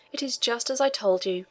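usual speaking voice

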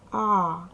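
А drawn out as a single open vowel; isolated vowel sound. Shown as a demonstration. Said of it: "голосний".

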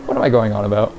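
ordinary talk

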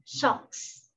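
socks whispered; whispered model word. Shown as a demonstration. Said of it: "Những chiếc tất chúng ta đọc là". (thì thầm chữ socks)

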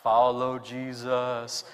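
low, drawn-out, solemn call; low slow call. Retold or imitated. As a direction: intone it low and slow, like a trance. Flat voiced disciples' call.

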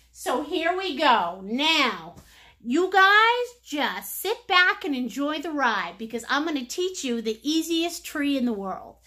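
ordinary delivery

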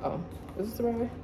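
talking while chewing gum. Real, not acted. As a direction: gum in the mouth. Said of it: "I got gum in my mouth".

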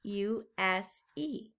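slow, letter by letter; spelling out. Shown as a demonstration. Teacher spells the word for children. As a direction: spell each letter out slowly. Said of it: "remember because".

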